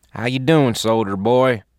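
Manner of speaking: lightly voiced Southern medic; southern accent. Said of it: "with a southern accent".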